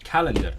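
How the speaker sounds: "Calendar" stressed on the first syllable, like English; stress on CAL. Shown as a demonstration. Learner settling the stress of "Calendar", like English. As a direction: say "Calendar" with the weight up front. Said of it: "Okay, like English".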